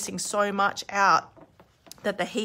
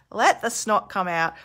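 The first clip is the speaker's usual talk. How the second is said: ordinary delivery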